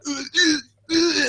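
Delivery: high, strained ugh grunts; playing the kicking victim, high strained grunts; mock struggle grunts. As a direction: grunt like kicking to break free.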